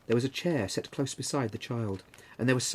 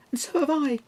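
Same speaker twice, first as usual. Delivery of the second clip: much higher, louder eager exclamation; exclaimed; 6 dB louder than usual. A narrator's reading. Another child to mother, exclaiming eagerly. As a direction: chime in keenly. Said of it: "exclaimed another".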